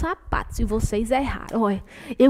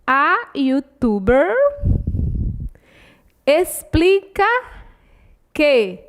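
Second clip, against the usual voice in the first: very slow, spaced "A youtuber explica que" with pauses; slow emphatic reading; much slower than usual. Shown as a demonstration. Teacher spelling out the sentence word by word for students. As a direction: drag out each word, pausing before "que". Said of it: "Eu posso substituir esse que".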